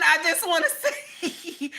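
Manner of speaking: giggling through the words; real giggle breaking the line; laughing. Real, not acted. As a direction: laugh while you try to talk.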